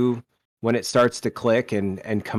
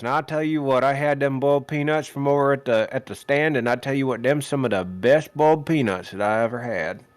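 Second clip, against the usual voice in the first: Southern announcer; 2 semitones higher, faster than usual. Retold or imitated. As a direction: hawk boiled peanuts like a small-town Southern announcer, only a hint of the accent. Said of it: "did you channel the guy that we heard, the announcer".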